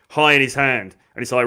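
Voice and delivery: ordinary delivery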